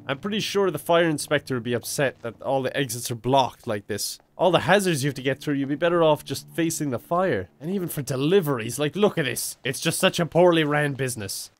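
usual speaking voice

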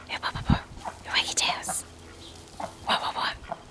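soft breathy wop wop to the ducks; whispered duck call. Real, not acted. Owner coaxing her ducks with a whispered call. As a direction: whisper the call to coax them.

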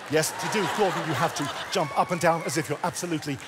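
ordinary talk, nothing marked

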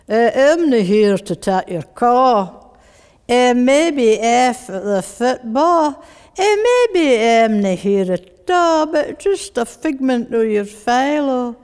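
slow, drawn-out Dundonian recitation; rougher Dundee accent. Shown as a demonstration. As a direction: recite as a broad Dundee answering-machine message. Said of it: "if I do it a bit deeper".

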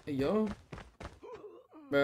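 ordinary talk

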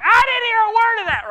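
lady at the back complaining to the preacher, hammed-up high and loud. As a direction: whine it like she missed everything. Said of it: "the lady's like".

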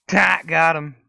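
high, loud burst of triumph; triumphant shout. Real, not acted. Gamer celebrating a hit, triumphant shout.

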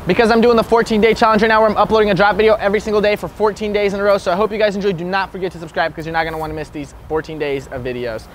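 upbeat fast sign-off, loud and high; YouTube outro. Acted. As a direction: pump up the outro.

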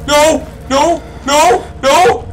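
high loud panicked nos; panicked shouting. Real, not acted. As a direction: yell in real fright. Sudden event in game.

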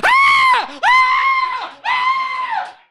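high shrieking screams, fleeing; panicked high shrieks; terrified demon scream. Acted. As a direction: scream like demons running away.

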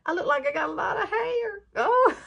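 high, laughing, thrilled at her own hair; delighted laughter, a little teary. Real, not acted. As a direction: giggle through the words with real joy.

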